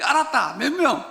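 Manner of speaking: blunt Gyeongsang reply. Retold or imitated. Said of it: "경상도 여자들이".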